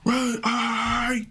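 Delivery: high squeaky mock panic voice; mock hype reaction. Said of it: "What was that?".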